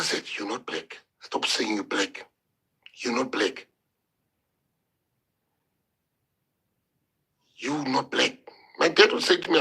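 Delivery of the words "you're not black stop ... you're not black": imitating a father, lower and much softer; father telling his son what he is, low and hushed; stern father. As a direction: lay down the law in a low, quiet voice. Father voiced in a story.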